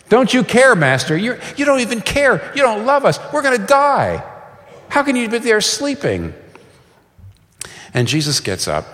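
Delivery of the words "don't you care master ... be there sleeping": high, frantic pleading to the master; panicked disciples. Retold or imitated. Disciples crying out to a sleeping Jesus in panic. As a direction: whine it out, scared they'll drown.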